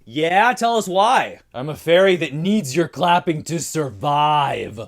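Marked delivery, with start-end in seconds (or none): 1.5-4.8 drawn-out, loud camp plea; flamboyant. Acted. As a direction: stretch the words out flamboyantly.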